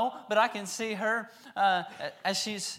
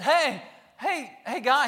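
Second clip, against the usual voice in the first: higher, louder hail; calling out; 8 semitones higher, 8 dB louder, slower than usual. Acted. Healed men hailing the possessed man, loud. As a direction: flag him down.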